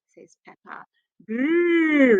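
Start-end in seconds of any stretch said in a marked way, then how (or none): 1.3-2.2 little dinosaur grrr; playful growl. Acted. George answering Peppa with a playful dinosaur growl. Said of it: "replies George".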